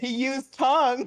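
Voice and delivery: disgusted wail. Acted.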